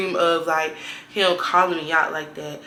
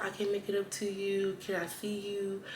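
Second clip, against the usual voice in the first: soft mock pleading; 12 dB quieter than usual. His apology retold softly.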